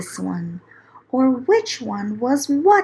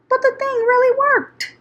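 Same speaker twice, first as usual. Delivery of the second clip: amazed; 13 semitones higher, faster than usual. A narrator's reading. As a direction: burst out in delight that it works. Narrator marvels machine works.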